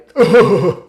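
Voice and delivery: loud rolling put-on laugh; imitated laugh. Friend loving the shut-up bit, big imitated laugh. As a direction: burst into a big laugh in character.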